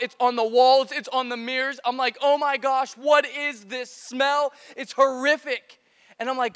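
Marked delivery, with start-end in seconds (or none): 2.2-5.8 raised, drawn-out dismay; appalled, higher-pitched outburst; horrified disgust. Retold or imitated. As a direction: exclaim slowly in mock horror at a stench. Acted horror at overflowing toilet's smell.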